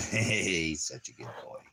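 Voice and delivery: normal talking voice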